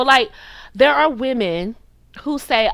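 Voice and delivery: ordinary delivery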